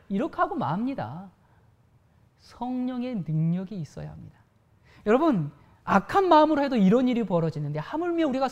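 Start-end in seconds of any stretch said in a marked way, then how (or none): none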